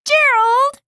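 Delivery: high, loud cry of a name; calling out. Acted. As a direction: call after a friend. Friend calls after him.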